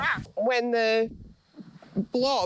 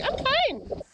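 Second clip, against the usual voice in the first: high, mock-bright "I'm fine"; mock chirpy denial. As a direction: parody her friend brushing it off. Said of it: "But you were like".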